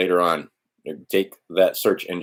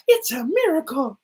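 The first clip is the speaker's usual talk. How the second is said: high, gasping, hammed-up wonder at a miracle; mock awe. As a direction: gasp and sell it as a fake miracle. Mocking biblical wonder.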